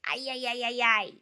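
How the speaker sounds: lightly voiced robot going ay ay ay, quick; mock robot chirp, fast. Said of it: "So I did a robot voice".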